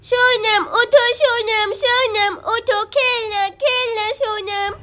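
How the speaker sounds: put-on slow, babyish little-boy voice; pleading toddler. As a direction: coax a friend to play like a toddler. Toddler Shyam wakes friend to play. (खेलने बुलाते छोटे बच्चे की तोतली आवाज़)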